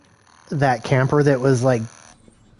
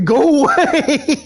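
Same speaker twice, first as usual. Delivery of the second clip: laughing mock shoo; 10 semitones higher, slower than usual. Imagined voice inside camper.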